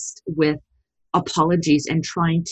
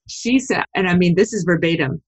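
ordinary delivery

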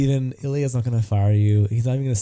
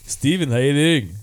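imitating a Montenegrin boss; friendly Montenegrin-accented greeting. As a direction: put on a Balkan, Montenegrin accent. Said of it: "Ilya Orlovich".

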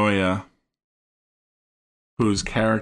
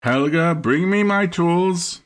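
put-on high, slow accented Helga bring me my tools; imitating accented movie line; 8 semitones higher, 3 dB louder, slower than usual. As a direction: quote the German-accented line slowly. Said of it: "So that's what he says".

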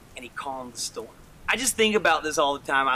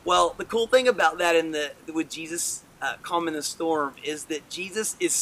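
usual speaking voice

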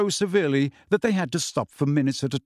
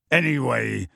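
plain reading voice

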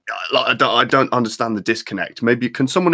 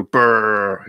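bomber engine noise. Retold or imitated. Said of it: "Lancaster go".

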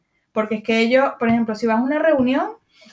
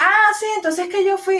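high, breezy carefree voice; clueless friend; 8 semitones higher than usual. Retold or imitated. Mocking a friend who resumes the chat a month later, high and breezy. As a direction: act oblivious to the month of silence.

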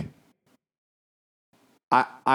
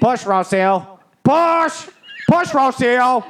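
high, loud doctor urging a push; imitated yelling; 11 semitones higher, 4 dB louder, much slower than usual. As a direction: yell like a doctor in delivery. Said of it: "Doctor Dell's yelling beside her".

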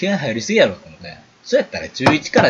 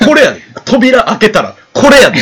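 high, loud laughing taunt; loud mocking taunt; 9 semitones higher, 11 dB louder than usual. To the boys at the door, a loud laughing mock. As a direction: belt the mockery out, cracking up. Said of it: "仁王立ちの真似しとって".

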